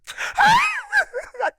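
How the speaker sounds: high sobbing cry; a high, tearful wail of emotion. Retold or imitated. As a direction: let out a high wailing sob. Tearful crying outburst.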